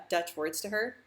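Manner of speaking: usual speaking voice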